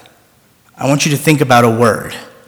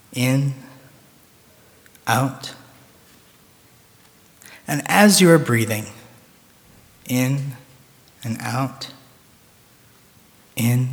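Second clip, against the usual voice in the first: soft, slow breathing cues fading away; guided meditation; 7 dB quieter than usual. Acted. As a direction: breathe with them, softly, slowly. Guiding breathing rhythm.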